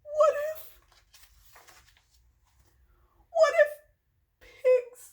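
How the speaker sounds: dramatic dread. Acted. Gerald fears pigs make him sneeze, dramatic dread. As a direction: let the awful thought dawn in pieces. Gerald's dawning horror in story.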